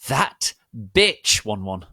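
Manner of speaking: loud, high furious shout; hammed-up angry yell. A narrator's reading. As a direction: yell it in outrage. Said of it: "I shouted angrily".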